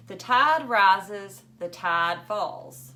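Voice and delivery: slow, measured reading of the verse; poem recital. Acted. Teacher reading Longfellow to class, slow recital. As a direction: let each line swell and ebb like the tide. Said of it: "The way Mister Berkway read this poem".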